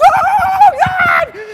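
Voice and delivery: very high, loud drawn-out cry; top-of-lungs scream. Retold or imitated. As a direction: scream it in full mock terror. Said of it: "screaming at the top of my lungs".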